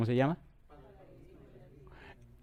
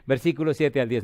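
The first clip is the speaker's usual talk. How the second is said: ordinary talk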